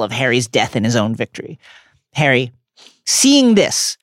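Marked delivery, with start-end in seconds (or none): none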